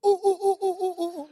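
high loud rapid oohs of pain. Retold or imitated. Steve running out patting his bottom, loud high oohs. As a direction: yelp ooh over and over while running. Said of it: "like this".

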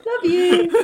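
high, loud mock-affectionate call; jokey sweet shout. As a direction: sing out cheeky affection after the insult. Jokey call after savaging the character.

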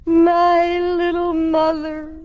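slow, high sentimental wail; crying. Acted. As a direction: sob it out, stretching the words. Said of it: "please don't cry".

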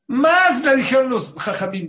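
loud, high burst of emphasis; high-pitched storyteller's surge; emphatic outburst. Real, not acted. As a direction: raise the voice sharply to press the point. Emphatic retelling, voice jumps up.

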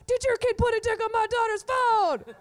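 high, loud accusing mom. Retold or imitated. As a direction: hammer each parent like a furious mother. Mom dialing every parent.

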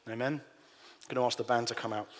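ordinary delivery

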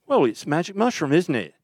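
high-pitched English madman drummer; imitating a rocker explaining the obvious. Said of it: "he goes".

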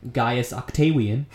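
OCTAVIAN with a W in place of the V; Latin pronunciation. Shown as a demonstration. Host giving Augustus's birth name, Latin pronunciation. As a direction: say OCTAVIAN as ok-TAY-wee-an, slowly. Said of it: "you get names like".

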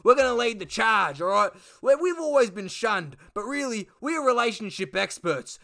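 high, loud mock rant; ranting Duck. Duck lecturing the room on the sexual revolution, high rant.

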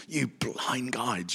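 slow, hushed whisper. Acted. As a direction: breathe it slowly like a secret. Quoting Jesus for effect.

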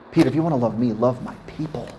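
usual speaking voice

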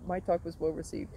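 ordinary talk

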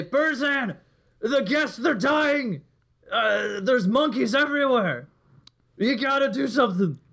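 high-pitched, urgent plea; panicked alarm. Acted. Player acts Pavel's feigned alarm.

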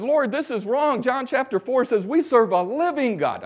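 indignant shout to the Lord; outraged prayer. Retold or imitated. Preacher recalling praying at Thai statue, loud outraged cry. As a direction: cry out to God, high and loud.

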